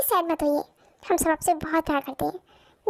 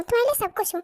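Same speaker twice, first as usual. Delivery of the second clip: ordinary talk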